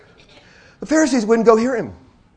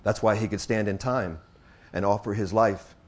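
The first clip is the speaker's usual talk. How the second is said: low, soft, reverent delivery; solemn preaching; 10 semitones lower, 7 dB quieter than usual. Real, not acted. Preacher telling of the judgment borne on the cross, solemn preaching. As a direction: let each phrase settle, hushed and grave.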